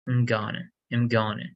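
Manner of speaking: "I'm gonna" blurred into um-GUN-uh; "I'm gonna" with the "I'm" barely sounded; reduced linking. Shown as a demonstration. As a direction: repeat "I'm gonna" as one linked word. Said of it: "change this to I'm gonna".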